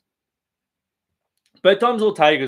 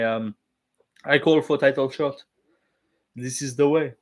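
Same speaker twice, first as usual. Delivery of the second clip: hammed-up French-accented English. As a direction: put on a generic French fighter's voice. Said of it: "doing a fucking normal French voice".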